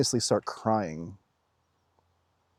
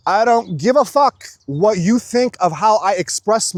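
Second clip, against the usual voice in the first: high, loud, defiant declaration; passionate; 8 semitones higher, 6 dB louder than usual. Real, not acted. Telling critics they don't matter, loudly passionate. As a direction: declare it with unapologetic force. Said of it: "in this passionate place that I am".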